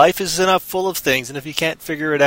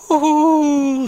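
high gleeful whoop; woo-hoo; 12 semitones higher than usual. Acted. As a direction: whoop with carefree joy. Character jumps in the river.

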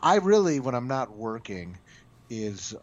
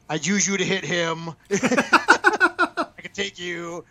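playful threat, laughing through it; 7 semitones higher, 9 dB louder, much slower than usual. Retold or imitated. Ellison sizing him up, punchline.

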